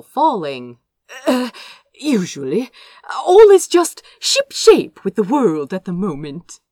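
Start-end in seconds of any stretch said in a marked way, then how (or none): 1.3-6.6 bright, louder, rushing breezy excuses; flustered young prince chattering his way out, fast and loud; cheery evasive Azra. A narrator's reading. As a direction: cover up with too much cheer. Azra correcting himself awkwardly.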